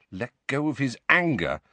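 usual speaking voice

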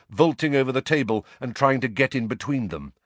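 ordinary delivery

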